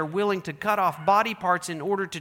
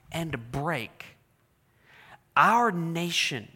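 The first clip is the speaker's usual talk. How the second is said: solemn preaching; 3 semitones lower, slower than usual. Acted. Slow solemn sermon point.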